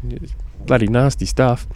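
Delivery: ordinary talk, nothing marked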